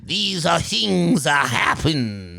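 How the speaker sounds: very slow, a touch of Greek; slow Greek-ish old man; Greek accent. Retold or imitated. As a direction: slowly try the Greek accent again. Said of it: "I think that's more Italian".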